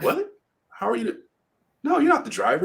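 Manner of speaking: very high-pitched disbelieving protest; incredulous. Retold or imitated. Witness to the living driver, retold in high-pitched disbelief. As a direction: imitate a flabbergasted man contradicting. Witness refuses to believe him.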